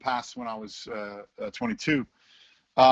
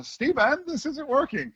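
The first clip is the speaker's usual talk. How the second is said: high-pitched, lightly voiced grandmother; a gentle grandmotherly scolding, pitched up; a hint of a disappointed grandmother; channeling grandmother; 12 semitones higher than usual. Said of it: "Quite often I channel my grandmother".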